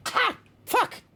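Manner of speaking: sudden high pained cry; startled yelp. Real, not acted. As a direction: yelp as a bug flies in. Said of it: "I think a bug went into my mouth".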